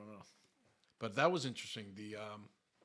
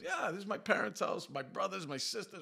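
neighbour voiced; 9 semitones higher, 5 dB louder than usual. Neighbour proudly explains family.

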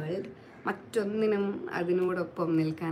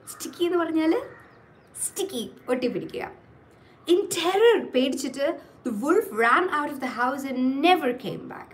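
animated, sing-song storyteller with acted dialogue; story reading to children; 6 semitones higher, 4 dB louder than usual. Teacher reading a wolf tale to children, expressive storytelling. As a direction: read it out expressively, leaning on key words.

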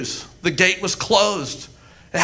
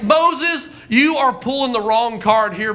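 usual speaking voice

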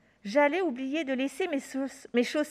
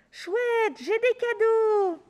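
put-on high, delighted child's cry; thrilled little boy; excited child; 8 semitones higher, 4 dB louder, slower than usual. A narrator's reading. Child finds presents.

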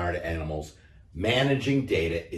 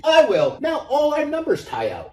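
much higher, shouted puppy cheer; yappy puppy; 5 dB louder than usual. A narrator's reading. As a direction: yap it joyfully like a little dog.

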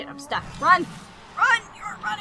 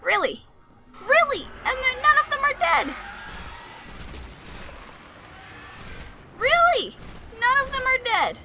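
exasperated shout; 10 semitones higher than usual. Real, not acted. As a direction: wail in frustration. Exasperated at enemies alive.

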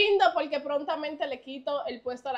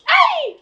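ay agudo y fuerte de susto; el gritico de susto al freír; 13 semitones higher, 10 dB louder than usual. Real, not acted. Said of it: "tiene que tirar el gritico". (gritico agudo al freír)

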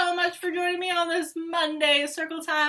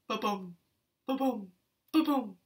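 heartbeat sound; 5 semitones lower, 5 dB quieter than usual. Acted.

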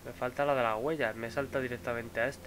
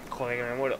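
hoarse with a cold. Real, not acted. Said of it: "sigo resfriado".

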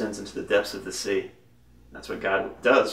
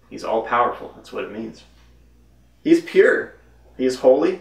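ordinary talk, nothing marked

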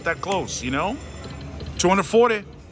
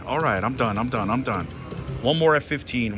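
ordinary delivery

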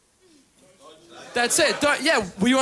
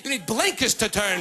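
snarky comeback; 4 semitones lower than usual. Retold or imitated. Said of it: "I said, Sweetheart".

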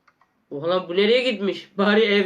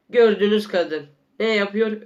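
ordinary talk

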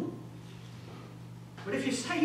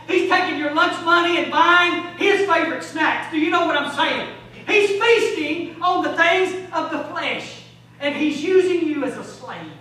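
loud, higher, building exhortation; rising preaching fervor; 4 semitones higher, 8 dB louder than usual. Real, not acted. Preacher warning of the demon squatting within, fervent preaching. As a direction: hammer each charge against the intruder.

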